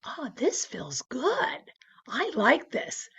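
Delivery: slow, whispered savoring; secretly thrilled, in a slow whisper; hushed delight. Retold or imitated. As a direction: whisper it slowly, relishing the feeling. Retelling her private delighted reaction.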